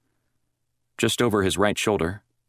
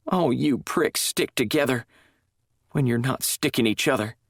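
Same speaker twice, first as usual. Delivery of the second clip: bitter; 8 semitones higher than usual. A narrator's reading. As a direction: spit the blame at them all. Sandy bitter at staff.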